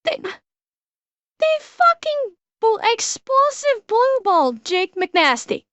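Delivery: outraged disbelief. Real, not acted. Outraged at the story.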